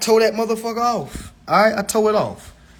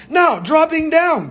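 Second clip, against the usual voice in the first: higher, slow lecturing professor; 9 semitones higher than usual. Shown as a demonstration. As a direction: announce it like a professor. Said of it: "I gotta put my, my professor voice on".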